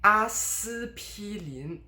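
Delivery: Aspirin drawn out as Chinese 阿司匹林, every syllable high and full; full first tone. Shown as a demonstration. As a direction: slowly sound out the si in Aspirin on a high level tone. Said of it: "should be first tone".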